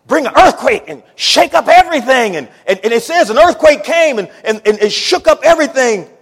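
loud, high preaching climax; full-force preaching. Acted. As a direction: build it up and thunder.

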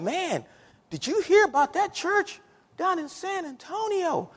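high, hushed mock astonishment; amazed gossip. Dallas people gossiping about a faraway church, amazed gossip.

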